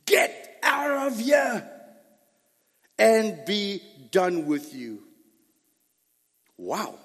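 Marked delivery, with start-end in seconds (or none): 0.1-5.1 a king roaring in a slow, dismissive shout; Pharaoh's raised voice. Retold or imitated. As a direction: bellow him out of the palace. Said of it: "that's how Pharaoh's voice was".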